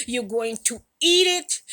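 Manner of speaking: ordinary delivery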